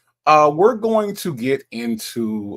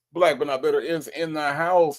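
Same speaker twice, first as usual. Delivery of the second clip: ordinary talk, nothing marked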